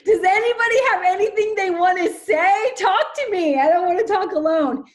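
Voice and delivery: eager pleading. Real, not acted. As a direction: beg the group to chime in. Pleading for the audience to speak.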